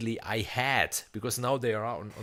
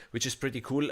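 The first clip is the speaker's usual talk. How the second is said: usual speaking voice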